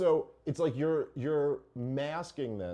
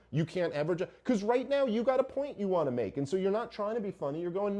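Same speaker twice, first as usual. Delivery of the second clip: ordinary talk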